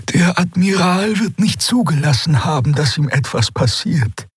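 high, hopeful alien woman; Xea's voice. A narrator's reading. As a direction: lift into a high, bright reassuring voice. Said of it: "sagte sie".

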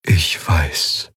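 sad. A narrator's reading. Eidolon already knows of the death, answering sadly. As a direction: let the words sigh out sadly. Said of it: "antwortete es traurig".

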